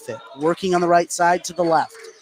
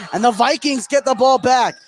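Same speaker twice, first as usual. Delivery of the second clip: excited play-by-play; 8 semitones higher, 3 dB louder than usual. Real, not acted. Vikings regain the ball, fast break.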